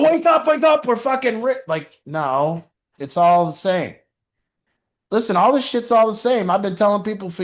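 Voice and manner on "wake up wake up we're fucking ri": much higher and louder, giddy mock yell; excited wake-up call. Husband waking his wife after a poker win, excited shout. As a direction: burst in shouting with joy. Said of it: "and I go".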